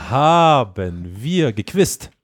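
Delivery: slow, drawn-out deliberate speech. Pretend. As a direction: stretch every word out slowly. (ganz langsam gedehnt)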